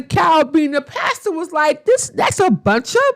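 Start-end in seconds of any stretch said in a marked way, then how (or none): 1.9-3.0 pastor's outraged quote. Retold or imitated. Pastor voiced in retold show.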